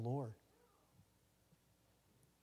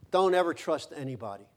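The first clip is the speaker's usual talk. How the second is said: much louder hard-edged warning; harsh shout; 2 semitones higher, 11 dB louder than usual. Retold or imitated. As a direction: bark the lesson at the fallen kid. Father scolds crying child.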